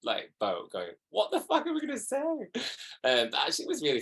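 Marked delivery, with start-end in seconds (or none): 1.1-2.2 panicked quote. Retold or imitated. Panicked quote among castmates.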